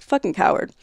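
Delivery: ordinary talk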